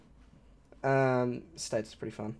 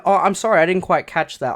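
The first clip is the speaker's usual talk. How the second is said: loud, hasty mock apology; over-eager sorry; acted apology; 4 semitones higher, 8 dB louder than usual. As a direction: play a quick, emphatic excuse. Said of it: "I would have said".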